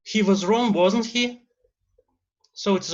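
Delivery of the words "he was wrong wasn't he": wasn't he rises high at the end; rising tag intonation. Shown as a demonstration. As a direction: lift "wasn't he?" up like a real question. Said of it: "a rising intonation".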